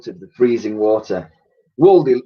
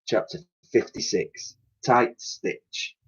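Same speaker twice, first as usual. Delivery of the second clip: ordinary delivery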